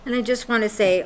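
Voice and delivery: usual speaking voice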